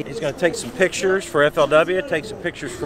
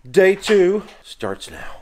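loud, drawn-out kickoff call; big showy day-starter; hyped announcement; 10 semitones higher, 14 dB louder, much slower than usual. Acted. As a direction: declare it high and slow, like an opening bell.

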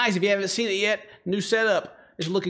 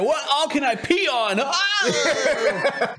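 mock excitement; 7 semitones higher, much slower than usual. Acting out someone treating it as game.

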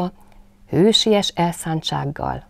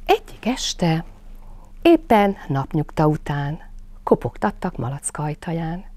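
ordinary delivery, nothing marked

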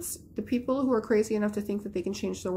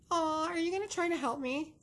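cooing pet talk; 7 semitones higher than usual. Real, not acted.

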